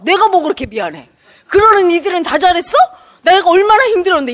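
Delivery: loud, high, resentful mock outburst; imitated flare-up. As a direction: burst out aggrieved, acting someone who snaps. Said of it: "그 순간 욱 하면서". (욱한 사람 흉내로 크고 높게)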